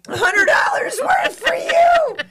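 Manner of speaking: high, slow, disbelieving echo; incredulous shout. Real, not acted. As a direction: echo it back in shock. Echoing friend incredulously.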